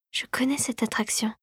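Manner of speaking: very soft, whispered thought; inward musing. A narrator's reading. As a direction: think aloud, lost in a memory. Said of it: "songea Clara".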